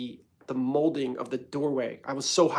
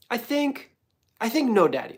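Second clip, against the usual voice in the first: imitating toddler; 9 semitones higher, 5 dB louder than usual. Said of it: "He's like".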